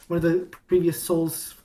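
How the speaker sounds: ordinary talk, nothing marked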